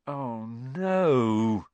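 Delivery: long, drawn-out groan of dismay. A narrator's reading. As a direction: groan it out very slowly. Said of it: "he groaned".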